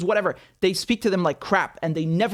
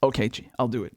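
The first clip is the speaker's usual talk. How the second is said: pretend Putin reply; 9 semitones lower, 3 dB quieter than usual. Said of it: "What do you think that conversation goes like?".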